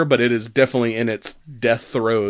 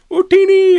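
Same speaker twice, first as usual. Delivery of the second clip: very high, loud squeaky Jawa shout; Jawa cry; 9 dB louder than usual. Retold or imitated. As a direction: shriek it like a tiny Jawa. Jawas shouting their cry.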